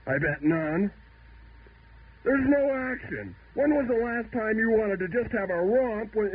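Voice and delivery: high-pitched, escalating indignant rant; a mock-outraged crank on his soapbox; worked-up rant. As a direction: get yourself all worked up about the old days. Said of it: "I'm getting much too worked up".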